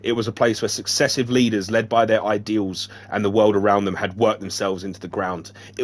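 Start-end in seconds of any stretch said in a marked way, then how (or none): none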